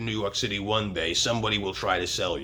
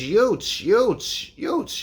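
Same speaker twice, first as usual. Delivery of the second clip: put-on Brooklyn accent; 13 semitones higher, 4 dB louder, much slower than usual. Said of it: "And he says".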